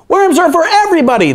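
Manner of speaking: very high, fast outcry; the frogs protesting together; cried out. A narrator's reading. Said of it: "they cried".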